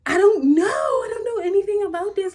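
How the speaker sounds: high, loud, near-tearful panic; panicked cry. Retold or imitated. Panicked retelling to her mother.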